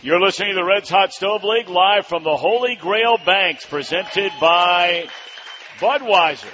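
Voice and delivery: loud, high announcer opening over the crowd; radio host intro. Acted. Host welcomes the live crowd to the show, loud announcer style.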